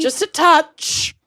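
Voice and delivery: high, slow mock-delicate voice; mock dainty. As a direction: say it like a prissy little sip. Mocking the quote.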